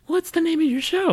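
very high mimicking voice; nosy questioner. Mimicking people asking about the podcast, nosy falsetto. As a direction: squeak it like a prying busybody.

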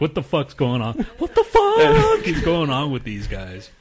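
mock-outraged retelling with a laugh; baffled outburst. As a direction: drag out the second "fuck", loud and high. Acting out her baffled outburst.

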